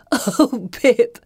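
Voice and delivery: high, amused exclamation through laughter; laughing. A narrator's reading. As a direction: laugh it out at a friend's antics. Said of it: "This makes Felicity laugh".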